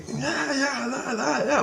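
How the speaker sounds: high, eager "yeah, yeah" with a laugh; high laughing agreement; excited high yes. Retold or imitated. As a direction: chirp it back like excited kids. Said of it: "And they're like".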